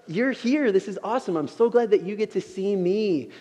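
high, delighted gushing; excited welcome. Acted. God meeting Helen face to face, acted excitedly. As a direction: play someone thrilled at an arrival. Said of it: "I'm so excited you are here".

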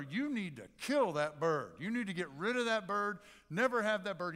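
loud, acted outrage; forceful complaint, climbing in pitch; indignant complaint. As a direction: demand it of the shop owner. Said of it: "tells the owner".

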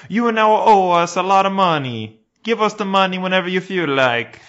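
high, loud put-on police voice; Italian officer writing a lazy fine; mock Italian cop. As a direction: hand over the fine with mock politeness.

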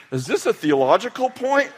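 high-pitched mock questioning; imitating baffled son. Son to preaching dad, high-pitched mock bafflement. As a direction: squeak it up, puzzled, as a joke. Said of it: "I think he was like".

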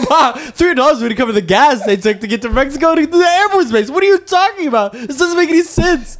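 high, relentless disbelief; exasperated rant. Retold or imitated. Son arguing the ransom wouldn't cover gas, exasperated. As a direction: rail at the absurdity, pitch way up. Retold rant at mom's gullibility.

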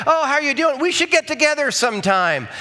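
high, sing-song casual greeting; breezy acquaintance. Acted. Acquaintance passing in the church hallway, breezy insincere greeting. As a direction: toss off an empty, friendly invitation.

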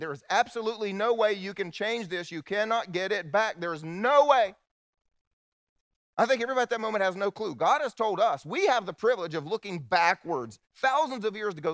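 booming sermon build; preaching at full force. Acted. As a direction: proclaim it from the pulpit.

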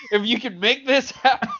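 high-pitched, laughing through the words; real laughter breaking up a joke appeal. Real, not acted. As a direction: struggle to finish the plea through real laughter.

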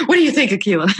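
asking through laughter; laughing. Real, not acted. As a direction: laugh while you ask.

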